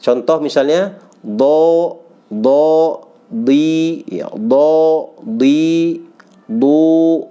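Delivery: teacher drilling dhad; letter drill. Shown as a demonstration. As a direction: chant dhad syllables thickly. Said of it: "huruf do ini".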